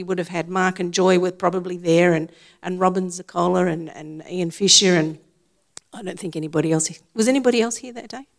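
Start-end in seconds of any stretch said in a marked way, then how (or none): none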